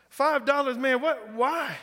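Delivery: put-on high, disbelieving Southern Louisiana accent; stunned by the price, in a Southern Louisiana accent; incredulous Southern Louisiana accent. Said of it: "I'm gonna try this Southern Louisiana accent".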